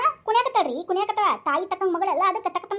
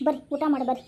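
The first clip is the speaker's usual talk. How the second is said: usual speaking voice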